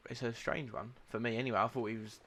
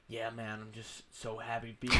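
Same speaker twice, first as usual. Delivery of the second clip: mock American player. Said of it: "the player was like".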